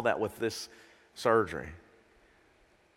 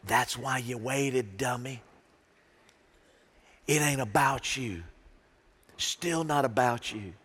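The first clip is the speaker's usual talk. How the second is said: slow, dry self-rebuke; slow teasing scold to yourself; inner scolding; slower than usual. Retold or imitated. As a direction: talk yourself down like a patient mentor. God's inner voice scolding him.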